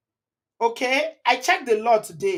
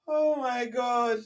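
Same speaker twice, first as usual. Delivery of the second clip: spent laughter; 3 semitones higher, 4 dB quieter, much slower than usual. Real, not acted. Recovering from laughter.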